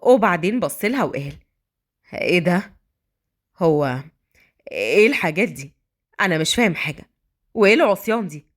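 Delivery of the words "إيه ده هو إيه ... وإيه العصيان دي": low, halting man's voice; Adham, bewildered. A narrator's reading. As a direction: puzzle over the food, mildly disgusted. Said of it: "وكان بيبص للأكل باستغراب". (باستغراب وقرف خفيف وصوت واطي)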